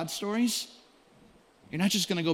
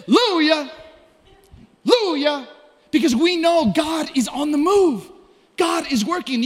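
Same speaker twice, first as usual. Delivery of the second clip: higher, louder preaching push; rally the church with rising fervor; full-force preaching; 7 semitones higher, 5 dB louder than usual. Acted.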